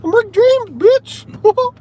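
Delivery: very high, squeaky cartoon mouse; high falsetto Mickey Mouse catchphrase. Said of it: "He came up to me and said".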